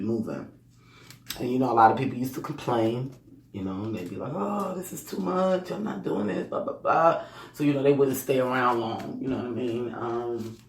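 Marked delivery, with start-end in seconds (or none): 4.3-7.2 higher-pitched imitation of a whiner; dismissive mock grumble, pitched up; mock complaining. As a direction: imitate someone griping and giving up. Voicing workers who used to complain.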